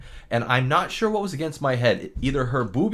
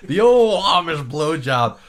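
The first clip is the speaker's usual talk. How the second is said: slow mock announcer; 4 semitones higher, 7 dB louder, slower than usual. Mock-grand repeat of joke.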